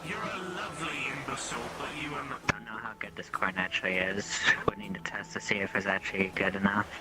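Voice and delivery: hushed unvoiced aside; whispering. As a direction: whisper it like a secret plan. GM whispers in character.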